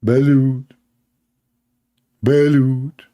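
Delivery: drawn-out street vendor cry; mild mock vendor yell; vendor calling out. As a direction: hawk it like a beach seller. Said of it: "he started screaming".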